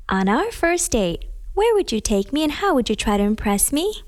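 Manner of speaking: ordinary talk, nothing marked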